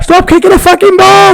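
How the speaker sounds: very high, loud shrieked telling-off; furious shout. Acted. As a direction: scream at kids to pack it in. Acted shouting at boys.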